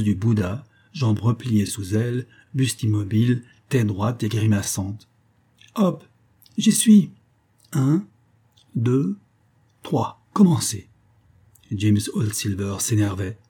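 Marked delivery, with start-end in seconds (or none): 5.7-10.9 playful brat counting off a take; cheeky girl voice. A narrator's reading. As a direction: impish kid calling action. Cheeky girl strikes a pose.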